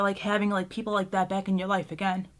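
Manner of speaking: normal talking voice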